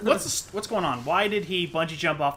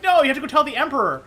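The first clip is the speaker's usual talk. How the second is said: mock urgent plea; 8 semitones higher, 5 dB louder, faster than usual. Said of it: "be like".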